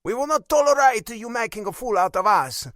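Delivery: put-on loud, high accented warning; stern Italian father, angry. A narrator's reading. Father warning his son against embarrassment, loud and angry. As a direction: warn him forcefully, Italian-accented.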